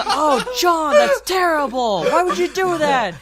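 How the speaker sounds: high, loud pretend horror; mock scolding. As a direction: gang up on him with exaggerated dismay. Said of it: "we're just going".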